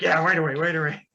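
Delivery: loud, eager agreement; eager yes-man. Acted. Eager hero answering the guard, loudly agreeing. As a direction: blurt it out, eager to please. Player's character eagerly obeys summons.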